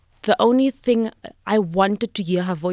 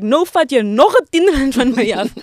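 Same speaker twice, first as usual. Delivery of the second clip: loud, high, laughing imitation of the aunt; laughing aunt quote; 7 semitones higher, 10 dB louder than usual. Quoting the late aunt teasing her sister in the car, laughing loudly. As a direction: quote the aunt's joke while cracking up. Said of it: "And she's like".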